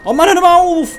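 very high, loud scream of protest; frightened shriek. Real, not acted. Player startled by the game, frightened shriek. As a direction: shriek it out, drawing out the end.

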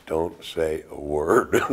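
low, slow, soft caution; hushed warning. Retold or imitated. Husband warning his wife near the comedy stage, hushed warning. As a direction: warn under your breath, drawing out each word.

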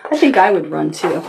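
ordinary delivery

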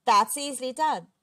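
giant's booming comeback; shouted retort. A narrator's reading. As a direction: call it back across the sea. Giant answers a shouted challenge.

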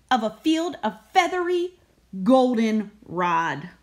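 ordinary delivery

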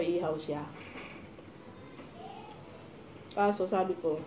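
eating while speaking; talking while chewing. Real, not acted. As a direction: mouth partly full of food. Seen 1: At the meal, she eats between phrases; jaw and cheeks look full.

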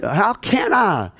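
high, slow, pleading cry of confusion; retold high, drawn-out plea to the hurt leaders; bewildered protest. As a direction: protest that you don't know what went wrong. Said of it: "I said".

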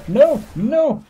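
high-pitched yelps of protest; alarmed cry. Real, not acted. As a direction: cry out as you get attacked. Attacked in the game.

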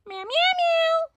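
slow, high, soft mews; little cat voice; toy cat. Acted. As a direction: mew sweetly like a small kitten.

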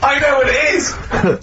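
high, slow and laughing, sure of the answer; excited outburst. Real, not acted. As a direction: blurt it out with a laugh, triumphant. Guessing the quiz headline.

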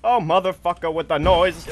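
ordinary talk, nothing marked

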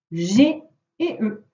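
ordinary delivery

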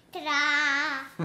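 loud, proud reveal; excited ta-da. Real, not acted. Child shows parent the finished drawing, excited. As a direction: call out a big, drawn-out ta-da.

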